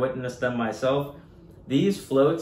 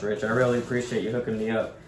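usual speaking voice

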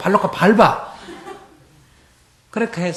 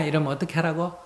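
ordinary delivery

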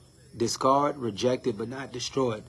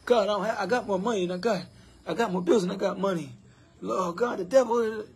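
mock complaining believer; 6 semitones higher than usual. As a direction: ham up a griping churchgoer listing woes. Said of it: "So when you're saying".

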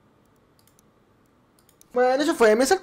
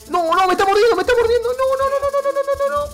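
pánico; 5 dB louder than usual. Real, not acted. La serpiente lo está mordiendo.

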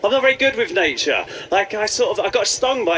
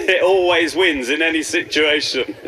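usual speaking voice

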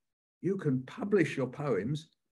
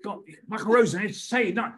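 higher and louder, eager insistence; insistent fan; 7 semitones higher, 5 dB louder than usual. Retold or imitated. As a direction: pester him to say it. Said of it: "people come up to me and go".

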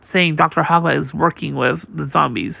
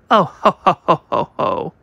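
villainous laugh. Acted. The doctor gloats as the heroes are shot down, evil laugh.

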